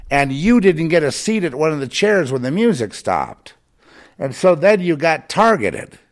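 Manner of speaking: high, loud ranting sermon voice; preaching at full force. Acted. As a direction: hammer it home like a fired-up preacher. Rising into full-force tirade.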